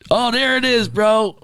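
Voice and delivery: high excited exclaim; light up, thrilled to find it. Real, not acted. Excited at finding the picture.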